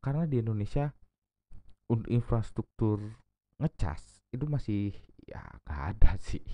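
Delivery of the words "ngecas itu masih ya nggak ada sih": whispering. Real, not acted. Whispered aside about charging.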